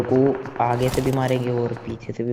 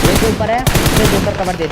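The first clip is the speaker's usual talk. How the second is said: cover me shout; 7 semitones higher, 8 dB louder than usual. Real, not acted. Calling for cover fire.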